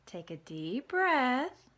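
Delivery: slow, calm spider voice; smiling coach. Acted. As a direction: coach him gently, smiling.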